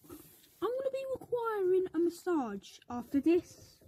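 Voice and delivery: ordinary delivery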